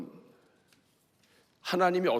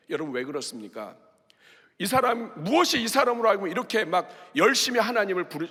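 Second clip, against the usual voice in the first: normal talking voice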